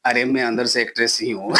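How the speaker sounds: ordinary talk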